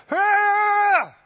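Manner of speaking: high, loud battle cry; imitated joyful shout. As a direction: let out a wild Viking yell. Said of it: "Kelly was shouting".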